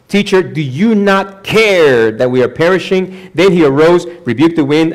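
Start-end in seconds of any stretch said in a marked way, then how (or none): 0.1-3.3 high, drawn-out plea, CARE stretched; pleading disciples. Acted. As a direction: cry out to your teacher, slowly. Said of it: "the disciples are talking to Jesus".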